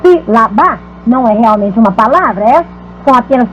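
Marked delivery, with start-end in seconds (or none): 0.0-1.0 "Sílaba" em três batidas, sí-la-ba; separado em sílabas. Shown as a demonstration. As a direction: dizer Sílaba pausando entre as partes. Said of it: "estou usando três sílabas". (Sílaba silabado)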